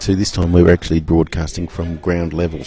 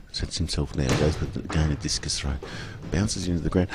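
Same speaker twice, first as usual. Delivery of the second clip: low, measured sports commentary; live play-by-play; 5 semitones lower, 9 dB quieter than usual. Real, not acted. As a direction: call the throw as it happens, low and contained.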